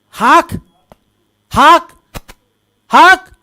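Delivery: short, punched "hawk" noise, rising in pitch; spitting-out noise. Acted. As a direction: imitate spewing from the mouth, one burst at a time. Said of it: "उनके मुंह से".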